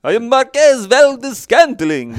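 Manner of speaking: high, booming vampire voice; loud, grand Dracula-style announcement. Pretend. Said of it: "his name sounds like a vampire's a little bit".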